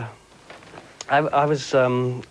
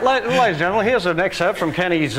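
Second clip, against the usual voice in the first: imitating host; 6 semitones higher, 4 dB louder than usual. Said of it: "And he said".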